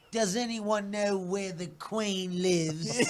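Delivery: slow, drawn-out mock British accent. Shown as a demonstration. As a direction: politely stretch it out in British. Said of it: "do your best British accent".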